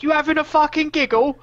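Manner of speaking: high, loud mock peasant yell; angry peasant. Quoting the Witcher meme peasant, mock furious. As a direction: shriek it like an outraged yokel. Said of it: "really funny meme of the guy from The Witcher".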